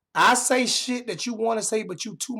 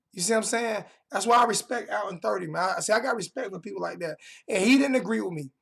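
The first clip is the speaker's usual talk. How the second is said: usual speaking voice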